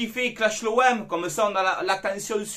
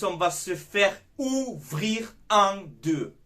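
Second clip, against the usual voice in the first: slow, heavy warning; much slower than usual. Shown as a demonstration. Fan warning his viewers, slow and heavy. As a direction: drag every word out slowly. Fan warns about weak defense.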